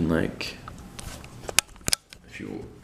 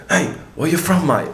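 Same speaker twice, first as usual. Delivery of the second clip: high, loud English "mate" impression; English accent; 10 semitones higher, 5 dB louder than usual. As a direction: imitate an English bloke calling out. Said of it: "I'm from England".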